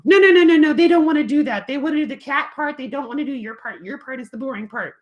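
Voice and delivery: high, loud pleading kitty; puppet cat voice. Acted. Cat puppet tells kids to do the cat part, high and loud. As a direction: insist like a bossy cat.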